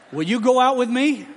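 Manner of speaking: "will you go out with me" high and carefully articulated; each word of "will you go out with me" distinct; clearer speech. Retold or imitated. Student's one rehearsed sentence, "will you" said clearly. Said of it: "when he could say it clearer".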